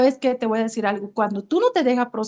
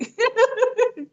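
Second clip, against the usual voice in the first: bright high burst of laughter; sudden high laugh; 11 semitones higher than usual. Real, not acted. Laughing at her own story.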